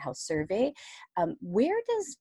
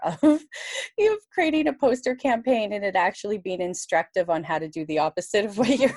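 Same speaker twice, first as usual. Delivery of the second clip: talking through laughter, pitched up; laughing; 4 semitones higher, 5 dB louder than usual. Real, not acted. Communications person confessing poster nightmare to guest, laughing. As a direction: let the laugh break into the words.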